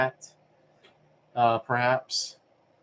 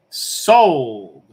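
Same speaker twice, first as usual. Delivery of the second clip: louder, drawn-out auctioneer's sold with a falling close; Sopranos-style sold; 8 dB louder than usual. Acted. Auctioneer closing the bid to the chat, mobster-style sold. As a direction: land the sale with a mobster's dramatic finality. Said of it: "inflection I use when I say sold".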